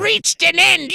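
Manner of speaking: high, loud, slow villain screech; drawn-out, piercing evil commander; Cobra Commander. Retold or imitated. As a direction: pronounce doom like a cartoon supervillain. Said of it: "I went full Cobra Commander".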